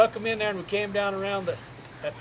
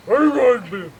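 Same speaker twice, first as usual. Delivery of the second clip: griping call; 5 semitones higher, 10 dB louder than usual. Retold or imitated. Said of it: "He went to griping at us".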